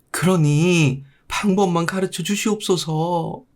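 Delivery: high, slow, wailing plea; pleading mother. Acted. Mother begging the monk to save her son, high wailing plea. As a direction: beg the monk with a high desperate cry.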